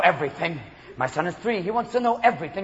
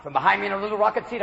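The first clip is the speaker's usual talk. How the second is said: ordinary talk, nothing marked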